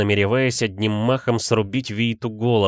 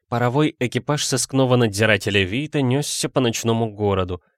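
ordinary delivery, nothing marked

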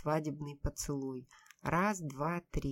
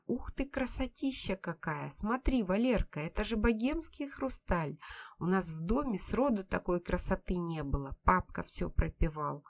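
gushing delight; 2 semitones higher than usual. Acted. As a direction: gush over the gift like a child. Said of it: "захлебнулась восторгами". (восторженно, захлёбываясь)

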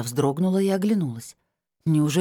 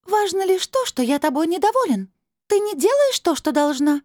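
high, louder, displeased otherworldly voice; icy crystal voice; 5 dB louder than usual. A narrator's reading. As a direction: scold coldly from up high. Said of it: "Голос похолодел, словно покрылся льдинками". (высоким ледяным голосом, с недовольством)

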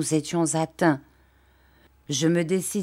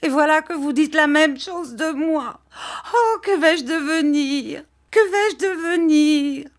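much higher, slowing tearful wail; weeping despair; 10 semitones higher, 4 dB louder than usual. Acted. Dora crying to her husband in despair, much higher. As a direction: cry out helplessly. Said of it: "elle pleurait de plus belle".

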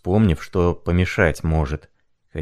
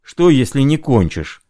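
loud worried outcry; high loud challenge; shout; 7 semitones higher, 4 dB louder than usual. A narrator's reading. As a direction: cry out in alarm. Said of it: "закричал Аркадий".

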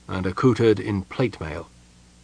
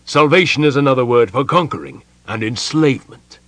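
loud, high, scornful retort; angry general's rebuttal; bitter, angry; 5 semitones higher, 8 dB louder than usual. A narrator's reading. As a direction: throw the claim back bitterly. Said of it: "gave a bitter, angry laugh".

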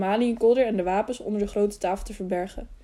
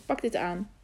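softer, lower hushed line; whispered; 3 semitones lower, 6 dB quieter than usual. A narrator's reading. Girl handing over a bundle, lightly hushed. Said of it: "fluisterde zij".

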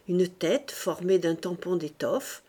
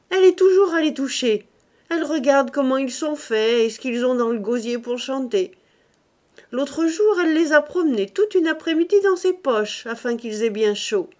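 loud, higher teasing outburst; shouting; 6 semitones higher, 7 dB louder than usual. A narrator's reading. Older brother teasing his sister about the bird, loud shout. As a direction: blurt it out mockingly. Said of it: "cria Octave".

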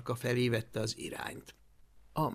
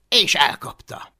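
plain reading voice

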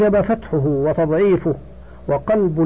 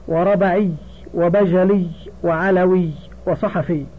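usual speaking voice

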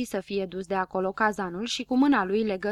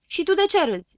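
higher, louder snapping rebuke; 8 semitones higher, 6 dB louder than usual. A narrator's reading. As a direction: snap at the laughing dwarf. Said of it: "se răsti la el Cărbunaș". (Cărbunaș se răstește la Fărâmiță, higher and louder)

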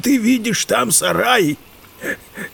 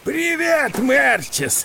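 hearty greeting; 7 semitones higher, slower than usual. Acted. Uncle greets nephew heartily.

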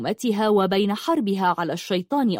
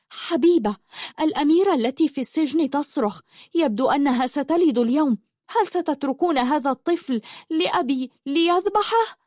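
higher, urgent frightened voice; frightened, worried; 7 semitones higher than usual. Acted. Daughter warning mother in fear through the mirror. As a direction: blurt out the alarming news in fear. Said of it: "يحمل خوفا وقلقا شديدا".

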